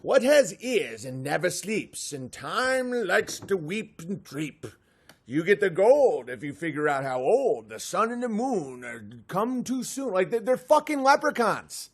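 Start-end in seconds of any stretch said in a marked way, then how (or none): 0.0-10.1 leprechaun riddle voice. Acted. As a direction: recite the riddle like a trickster sprite. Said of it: "And it's like".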